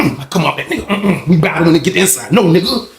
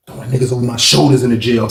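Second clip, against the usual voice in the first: normal talking voice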